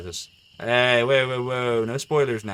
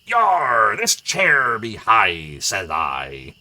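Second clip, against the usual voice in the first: loud, very slow hammed-up pirate drawl; mock pirate bellowing from an armchair; Simpsons pirate captain; 8 dB louder, much slower than usual. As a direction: growl it out like a cartoon pirate captain. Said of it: "And the pirate just goes".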